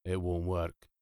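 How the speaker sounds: low, gloomy drone; depressed robot monotone; Marvin. A narrator's reading. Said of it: "droned Marvin".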